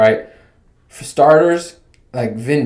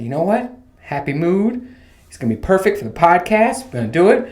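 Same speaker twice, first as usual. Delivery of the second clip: mock cheerful; 6 semitones higher than usual. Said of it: "I was in a good mood this morning".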